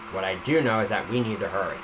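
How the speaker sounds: ordinary delivery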